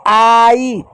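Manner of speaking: "आई" stretched out slowly; long "ai" sound. Shown as a demonstration. Teacher pointing out the shared vowel, slowly stretched. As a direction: draw out "आई" as a long diphthong. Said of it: "आई पढ़ रहे हैं".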